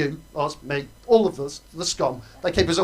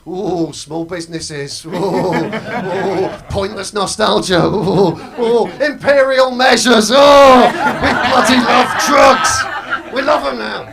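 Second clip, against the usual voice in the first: mock tabloid swoon building from slow coos to a loud higher shout; Daily Mail swooning; 4 semitones higher, 11 dB louder, slower than usual. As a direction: coo then gush into a yell of delight. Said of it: "the Daily Mail would be going".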